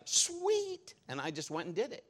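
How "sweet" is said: mock delight. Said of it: "And I was like".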